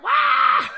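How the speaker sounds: high squealing shriek of shock; mock shriek. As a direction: squeal like the wife hearing big news. Wife's reaction in imagined scene.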